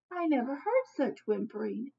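plain reading voice

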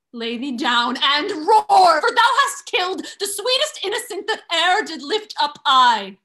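scornful fury. Acted. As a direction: hurl it at him. Emilia berates Othello.

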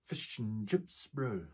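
put-on slow New Zealand accent; Kiwi accent. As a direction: drawl it out like a Kiwi. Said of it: "Australians can do Kiwi accents pretty well".

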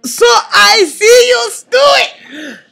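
high, loud cartoon shout; slow, booming greeting in a put-on voice; Rafiki. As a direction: call out like an excited cartoon character. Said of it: "try to do Rafiki's voice".

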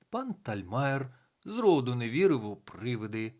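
plain reading voice

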